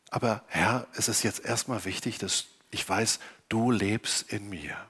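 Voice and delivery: ruhig und leise gebetet. Acted. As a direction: sprich zu Gott wie im stillen Gebet. Gesprochenes Gebet. (leise betend)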